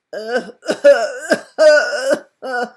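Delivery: loud, rough dragon's cough; a big hacking creature cough; harsh, barking cough from a sick dragon. A narrator's reading. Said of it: "coughed the dragon".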